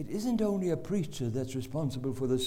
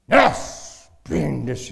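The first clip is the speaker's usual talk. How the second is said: barked order; 12 dB louder, much slower than usual. Retold or imitated. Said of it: "he says".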